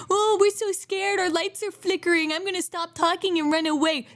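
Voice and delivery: mild mock panic; mock scared quote. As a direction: tease the scaredy friends. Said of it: "when the monster started coming around".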